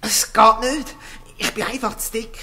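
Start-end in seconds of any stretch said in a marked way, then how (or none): 0.0-0.9 straining. Acted. Squeezing through the narrow bars.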